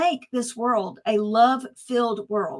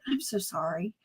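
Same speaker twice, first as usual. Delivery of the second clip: retold soft apology; 3 semitones lower, 10 dB quieter than usual. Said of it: "she looked at me and she said".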